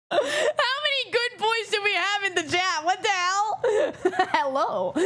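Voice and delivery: high voice breaking into laughter; amused disbelief, cracking up; laughing incredulously. Real, not acted. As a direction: talk through a giggling fit. Cracking up at chat's good boys.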